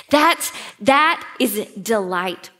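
normal talking voice

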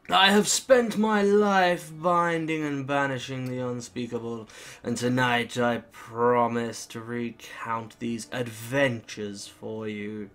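slow, portentous storyteller; grandiose demonologist. Acted. Dashford promises his audience tales of adventure, slow and grandiose. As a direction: stretch the words out like a theatrical lecturer.

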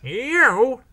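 high, loud, incredulous scoff. A narrator's reading. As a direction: throw it back in disbelief. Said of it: "scoffed Lumpy".

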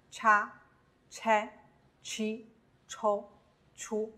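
叉、che、chi、抽、出, each one blended syllable; whole-syllable reading. Shown as a demonstration. Teacher drilling learners, 叉、che、chi、抽、出 each read whole. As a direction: say 叉、che、chi、抽、出 separately with a ch sound. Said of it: "整体认读啊".

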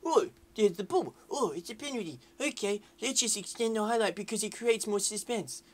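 high, silly mocking voice; mocking put-on voice. Gamer mocking the game for dragging out suspense, high put-on voice. Mocking the game's drawn-out highlight.

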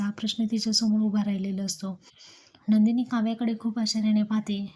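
usual speaking voice

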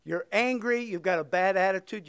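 ordinary talk

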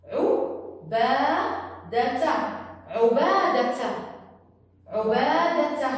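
عبادة slowly in syllables, ending "-data"; fatha ending. Shown as a demonstration. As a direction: sound out عبادة with final ta plus an a vowel. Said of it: "صارت إت مع الفتحة ت". (عبادة مقطّعة، التاء بالفتحة "تَ")

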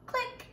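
high little clicking sound; a bright mouth click; camera click. Pretend. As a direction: click like a tiny camera shutter. Pretend camera shutter noise.

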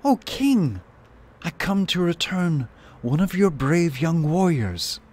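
high-pitched, ceremonious proclamation; formal herald's cry, pitched well up; loud courtly shout. A narrator's reading. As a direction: call out grandly as if addressing a throne. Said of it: "shouted out in a loud, courtly manner".

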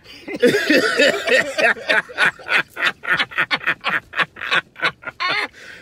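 laughter that won't stop; helpless loud cackle; long laughing fit. Real, not acted.

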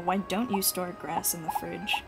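ordinary talk

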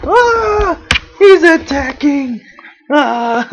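high, loud playful yelp; playful shriek. Real, not acted. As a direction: squeal as the dog pounces. Dog jumps on her playing.

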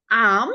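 "am" said short, loud and clear; teacher drilling "am". Shown as a demonstration. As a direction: call out "am" for the class. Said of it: "আমরা কি উচ্চারণ করব".

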